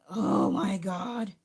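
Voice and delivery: slow, drawn-out gasp of disbelief; retold shock that he was so young; stunned disbelief. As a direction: let it sink in slowly, aghast. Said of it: "I was like".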